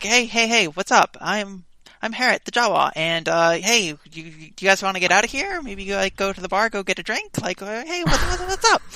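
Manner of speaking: high-pitched, loud, chattering little alien; Jawa. Shown as a demonstration. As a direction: pitch it up and rattle it off eagerly. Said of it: "pitch up my voice and talk real fast".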